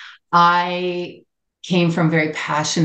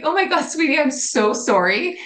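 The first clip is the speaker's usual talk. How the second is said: high, tender, pleading apology; re-enacted motherly remorse, pitched high; heartfelt retold apology; 10 semitones higher than usual. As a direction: apologise to your child, drawing out the sorry.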